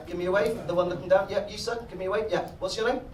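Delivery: ordinary delivery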